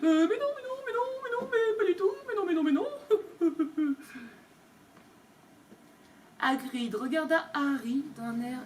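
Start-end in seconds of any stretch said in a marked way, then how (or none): 0.0-2.9 high, whiny, panicked babble; Uncle Vernon mumbling. Acted. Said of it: "marmonna quelque chose". 2.9-4.2 high whimpering laugh; a frightened little whine; nervous whimper. Acted. Panicked whimper.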